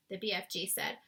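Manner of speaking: usual speaking voice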